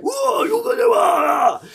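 very high shout of surprise, hammed up; a crowd yelling in mock amazement; mock shocked gasp. Crowd gasps in retelling.

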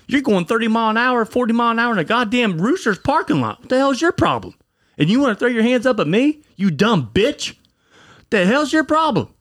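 high-pitched, indignant retold tirade; pissed-off rant. Husband chewing out the driver who nearly hit his pregnant wife, pissed off. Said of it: "I was so pissed off".

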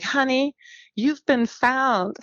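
high, slow, sugary "honey, you've been found"; sweet caller. Retold or imitated. Caller tells the adopted woman she was found, sweet and slow. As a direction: drawl it sweetly like a kind stranger. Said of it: "exactly how she said it".

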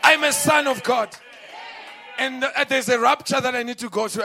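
roaring preacher's cry; preaching at full force. Real, not acted. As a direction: preach it out to the crowd. Preacher building up.

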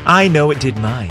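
usual speaking voice

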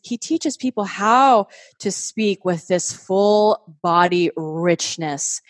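ordinary delivery

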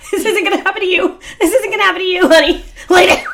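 high, laughing pleading to a dog; high laughing plea to her dog. Retold or imitated. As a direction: beg the dog while laughing.

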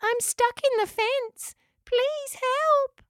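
very high, slow, tiny creature voice; little cry. A narrator's reading. Echidna stuck in the fence begs rescue, little high cry. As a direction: plead like a small trapped animal.

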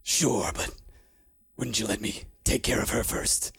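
low, hungry menace ending in a whisper; a lightly voiced bloodthirsty henchman; eager killer. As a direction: thirst for blood under your breath.

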